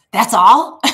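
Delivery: loud incredulous question; retold disbelief. Prophet questioning king's three arrows, retold disbelief. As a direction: imitate someone astonished at so little, punched loud.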